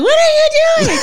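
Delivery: high, laughing "what are you doing" in disbelief; mock shocked squeal. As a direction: squeal it out, scandalised. Shocked reaction retold, laughing.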